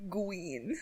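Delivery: Gween with a w for the r, soft and lisping; baby talk Gween. Pretend. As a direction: say Gween like a toddler. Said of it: "Green Sonic".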